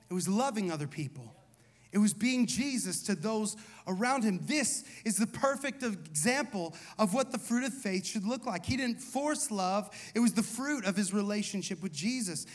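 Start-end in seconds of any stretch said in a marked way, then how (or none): none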